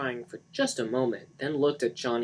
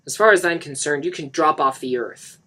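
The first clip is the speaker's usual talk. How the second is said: cold anger; 4 semitones higher, 10 dB louder than usual. A narrator's reading. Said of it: "Mark said".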